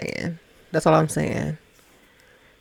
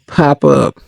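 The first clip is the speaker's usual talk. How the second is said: normal talking voice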